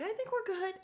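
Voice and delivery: a high, dainty Kathy; imitating Kathy. Host mimicking tipsy Kathy ending the talk, high mock voice.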